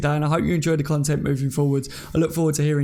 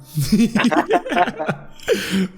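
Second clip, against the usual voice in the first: laughing; 7 semitones higher, 3 dB louder than usual. Real, not acted. Laughing at Connor's Cardiff remark.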